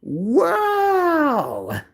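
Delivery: slow, drawn-out high wow; exaggerated wow. Real, not acted. Streamer mocking a rude chat comment, drawn-out wow. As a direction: stretch the wow out in disbelief. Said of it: "double the fucking wow".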